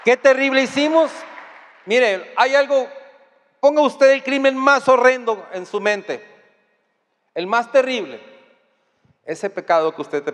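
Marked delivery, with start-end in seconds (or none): 0.0-8.2 full-force preaching. Real, not acted. Preacher exhorting congregation.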